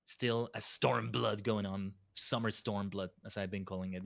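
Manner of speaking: usual speaking voice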